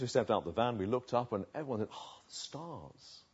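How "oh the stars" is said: very soft, slow gasp of wonder; imitating a hushed, stargazing crowd; awestruck hush. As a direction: breathe it out slowly in awe. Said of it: "we were awestruck".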